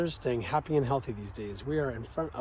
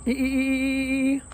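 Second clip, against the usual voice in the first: dolphin squeak; 13 semitones higher, 8 dB louder than usual. Pretend. Joke for students, dolphin chirping E. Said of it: "What's a dolphin's favorite letter?".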